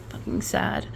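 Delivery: breathy whispered curse; a low whispered aside; whispered disgust. Real, not acted. Disgust at short sentences.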